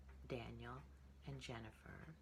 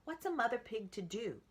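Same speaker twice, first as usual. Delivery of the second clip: fretting mother; 9 semitones higher, 8 dB louder than usual. A narrator's reading. Mother pig at a loss, fretful higher voice. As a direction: wring your hands out loud. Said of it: "said Piggy's mother".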